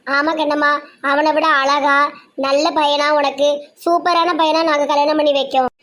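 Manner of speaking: ordinary talk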